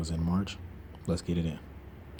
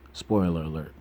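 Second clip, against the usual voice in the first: ordinary delivery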